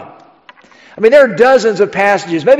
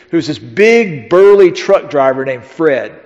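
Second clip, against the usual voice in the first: ordinary talk, nothing marked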